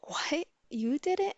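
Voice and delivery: retold disbelief, pitch jumping up; imitating shocked friend. Friend hearing the confession, imitated shocked disbelief.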